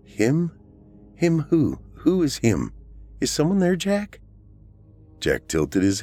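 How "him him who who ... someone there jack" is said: put-on high-pitched questioning of a toddler; puzzled aunt. A narrator's reading. Aunt asks little Jack who is there, puzzled and high. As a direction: gently quiz a little child, voice pitched up.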